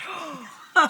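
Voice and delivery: high, sharp gasp of offence; mock outraged gasp. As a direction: gasp as if insulted to your face. Outraged at the steward's insult.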